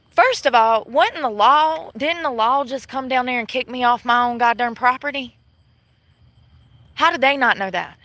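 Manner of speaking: loud, high indignant yell; real outrage at the police; furious outburst. Real, not acted. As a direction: punch first of all, then rant. Indignant rant at the law.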